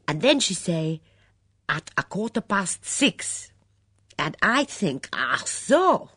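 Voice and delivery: put-on a worked-up refugee cook spilling gossip, foreign accent; Mitzi, excitable foreign maid. Mitzi's foreign voice, long tale.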